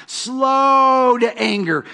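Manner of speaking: slow to anger, stretched high and long; slow, drawn out. Shown as a demonstration. Preacher savoring slow to anger for the congregation, drawn out. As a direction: let each word of slow to anger linger.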